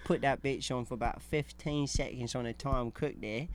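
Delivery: put-on working-class English accent; British accent. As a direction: tell it as a cheeky British lad. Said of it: "I feel like a British accent".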